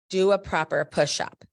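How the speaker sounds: loud barked command from the coach; coach's order. Retold or imitated. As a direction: bark it like a gym coach. Coach barking order at student.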